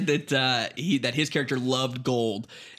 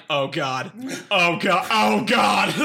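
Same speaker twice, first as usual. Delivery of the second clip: each repeat louder and higher, mock horror; escalating exasperated wail; mounting dismay; 4 semitones higher, 3 dB louder, much slower than usual. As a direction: build from usual voice to a loud cry. Retelling growing dismay while reading.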